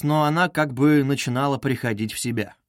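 ordinary delivery, nothing marked

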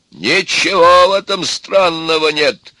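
loud, high, drawn-out exclamation; exclaiming. A narrator's reading. Grandfather backing the young suitor, loud exclamation. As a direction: cry it out emphatically. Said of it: "воскликнул дед".

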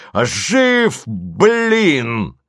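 высокий, протяжный вопль; кричи, больше не сдерживаясь; несдержанный крик. A narrator's reading. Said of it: "закричал Голубович, более не сдерживаясь". (ликующий крик без удержу)

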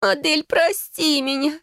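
high, slow tearful plea; sobbing moan. A narrator's reading. Sister begging Adele's forgiveness, sobbing. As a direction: wail it through choking tears. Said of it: "захлёбываясь слезами, простонала она".

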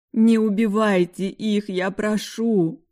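loud wailing; wailing plea. Acted. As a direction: wail the plea through tears. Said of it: "выла я".

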